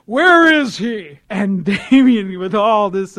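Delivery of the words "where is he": loud, high, drawn-out demand; intense demand. Retold or imitated. Preacher turning from prayer to find Batman, loud intense demand. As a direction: boom it out, stretching every word.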